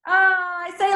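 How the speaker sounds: high, loud "oh!"; exclaimed warning cry; alarmed cry. Shown as a demonstration.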